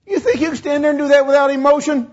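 high, fervent rhetorical question; emotional challenge. Real, not acted. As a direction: challenge the crowd with feeling.

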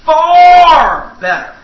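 FAR stretched long, high and loud; preacher's long, loud punch on FAR; drawn-out emphasis. Real, not acted. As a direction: belt out FAR, holding the vowel. Preacher building on "better.

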